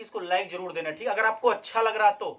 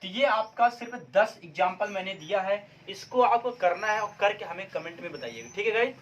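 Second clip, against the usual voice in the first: ordinary talk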